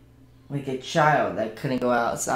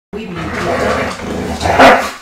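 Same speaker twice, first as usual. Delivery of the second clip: louder, slow, drawn-out victory cry; triumphant yell; 6 semitones higher, 10 dB louder, slower than usual. Real, not acted. Streamer celebrating beating the Summers, slow victory roar. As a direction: roar it out like a champion.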